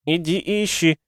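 высокий звонкий голос ребёнка; как ребёнок, повелевающий сверху; детский голос. A narrator's reading. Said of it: "снова прозвучал детский голос". (звонкий детский голос)

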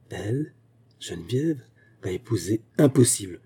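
stunned disbelief. Acted. Stunned man hearing of remarriage.

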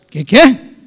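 startled double question, jumping up; acted disbelief. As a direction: re-enact being taken aback by the news. Reacts to shocking news in retelling.

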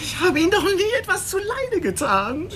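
high, crying protest; tearful plea. Acted. Beaten young man pleads with the cop, tearful and high. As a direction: plead through tears, wounded. Drugged suspect pleads after beating.

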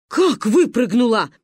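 loud, slow disbelieving question; seething shock. A narrator's reading. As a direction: boil over in alarm. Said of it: "заклокотала Ба". (клокоча, громко и медленно)

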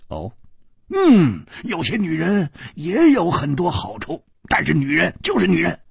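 high, loud, hearty teasing; boisterous Hu Tiehua. A narrator's reading. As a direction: rib his friend with gusto. Hu Tiehua raving about a woman.

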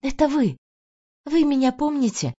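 gently surprised recognition; surprised and sympathetic. A narrator's reading. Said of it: "с удивлением и сочувствием".

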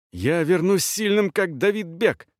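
high, breathless youthful cry; breathless shout. A narrator's reading. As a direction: gasp it out eagerly. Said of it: "задыхаясь, крикнул Ростом".

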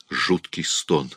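plain reading voice

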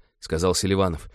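plain reading voice

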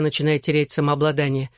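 usual speaking voice

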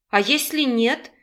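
ordinary delivery

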